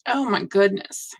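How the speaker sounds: usual speaking voice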